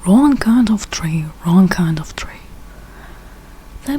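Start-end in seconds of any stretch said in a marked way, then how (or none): none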